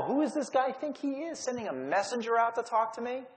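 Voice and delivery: a wounded general scoffing under his breath; indignant pride. Retold or imitated. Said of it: "pride is injured".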